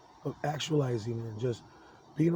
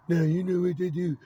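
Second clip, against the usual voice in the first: lightly put-on taunting voice, higher and louder; mocking taunter; 5 semitones higher, 8 dB louder than usual. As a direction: mimic the pestering voice, loudly. Mimicking the voices taunting him.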